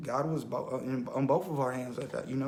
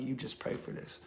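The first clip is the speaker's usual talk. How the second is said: a secret whispered instruction; hushed voice of God; 8 dB quieter than usual. Retold or imitated. God whispers to him in the bathroom, hushed. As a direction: whisper it, barely voiced and soft.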